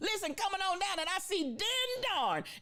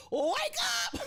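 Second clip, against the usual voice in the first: commanding wake-up cry. Retold or imitated. Mother's prayer commanding the watchman, high shout. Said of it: "I command you".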